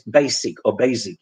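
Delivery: "basic" ending in a tense "-seek"; "basic" as "baseek"; Polish vowel. Shown as a demonstration. Teacher showing the Polish mistakes in "basic". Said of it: "they say".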